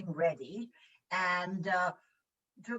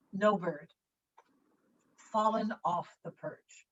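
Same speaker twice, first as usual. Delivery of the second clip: normal talking voice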